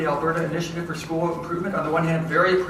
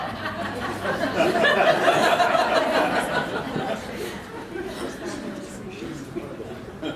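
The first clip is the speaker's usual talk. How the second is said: laughing; 13 semitones higher than usual. Real, not acted. Audience reaction to tough job.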